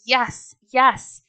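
loud, high eager shout. A narrator's reading.

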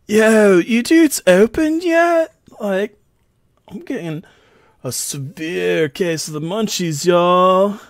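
high, drawn-out dude voice; loud, stretched-out greeting of a hungry pal; Felinor. Acted. Felinor at the door begging for munchies, drawn-out dude voice. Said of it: "what sounds like, uh, Felinor".

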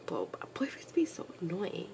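breathy whispered complaint under a sigh. Real, not acted. Vlogger whispers a complaint about boyfriends after a sigh. Sighing aside.

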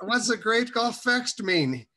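put-on high, loud puzzled teenager; baffled questions. Young reader confused by a Bible phrase, high and loud. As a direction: sound totally lost about the phrase. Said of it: "And I said".

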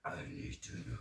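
unvoiced teasing whisper. Real, not acted. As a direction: breathe it as a secret.

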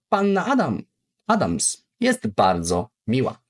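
ordinary talk, nothing marked

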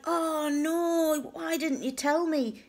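high, wailing retold dismay; mortified. On learning what the uncle was saying, mortified. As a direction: protest it high, acting out embarrassment. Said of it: "I'm mortified".

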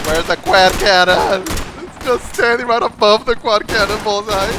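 excited shout. Real, not acted. Spotting the enemy chopper mid-fight.

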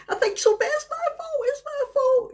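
very high, rising wail of guilt; the daughter breaking down, pitch shooting up; imitating hysterical daughter. Mother imitating daughter sobbing that the crash was her fault, high wail. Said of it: "And she started like that".

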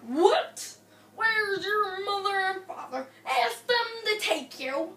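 put-on a startled, put-out old grandpa; grumpy grandpa. Grandpa asked to go to Sesame Place, loud surprised.

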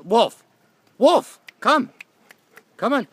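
high, loud, urgent calls; shouting. Real, not acted. Owner calling Wolf to come, shouting high. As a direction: shout the dog over to you.

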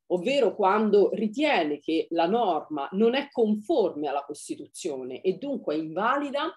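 ordinary talk

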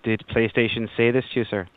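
ordinary talk, nothing marked

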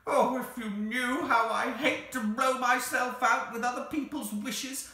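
high, loud, emotional wail; tearful creature. A narrator's reading. The Psammead begs to stop granting wishes, tearful. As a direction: pour it out like a creature near tears. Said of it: "it said in a tearful voice".

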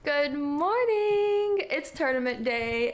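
drawn-out, high, loud sing-song; excited greeting. Real, not acted. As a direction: stretch it out like a wake-up call. Cheery tournament-day greeting.

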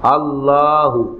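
"আল্লাহু" slowly, drawing out the "লা"; long second vowel. Shown as a demonstration. Teacher showing how the word is built, slow long-vowelled "আল্লাহু". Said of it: "আসে ছিল এমন".

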